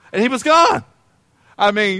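normal talking voice

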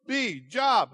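put-on high, loud eager kid voice; imitating his son. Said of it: "He goes".

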